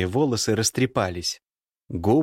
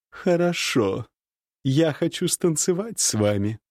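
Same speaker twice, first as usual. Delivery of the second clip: higher playful lady's voice; flirty woman; 6 semitones higher than usual. Acted. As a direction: invite him to dance sweetly. Nastasya voiced. (кокетливый женский голос)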